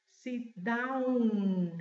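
"down" drawn out to its final N; sounded final N. Shown as a demonstration. Teacher explaining spelling, "down" with a clear final N. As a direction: hold the N at the end of "down". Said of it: "se pronuncia la N final".

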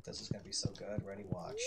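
unvoiced whisper. Real, not acted. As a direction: whisper it in suspense. Whispering anticipation.

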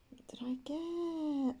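breathless whispered question; high tense whisper. Real, not acted. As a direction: hush it in suspense. Tense moment, egg hatching.

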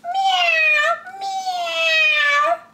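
very high, drawn-out mewing; squeaky-high cat cry; cat's meow. Acted. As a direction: meow like a storybook kitten. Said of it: "cried the cat".

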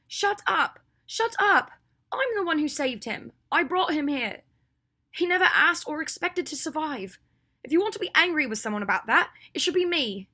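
high, loud, impassioned outburst; furious defence. Acted. Hermione defends Draco to Ginny, furiously shouting. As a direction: shout her down and take the blame.

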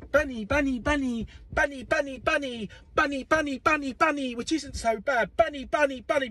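put-on high sing-song child voice; lightly voiced little girl chanting; imitating the child. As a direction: bounce the words like a toddler. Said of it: "Luna bouncing ... going".

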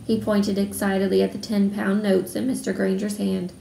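plain reading voice

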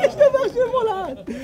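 high laugh into praise. Real, not acted.

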